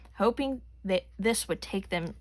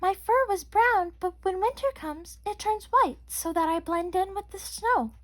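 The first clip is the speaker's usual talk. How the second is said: bunny voice; 10 semitones higher than usual. A narrator's reading. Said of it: "she replied".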